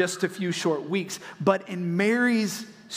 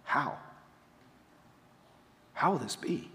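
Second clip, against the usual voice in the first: quieter, hushed asking; gentle bewildered Mary; soft wondering question; 3 semitones lower, 7 dB quieter than usual. Retold or imitated. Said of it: "She just says".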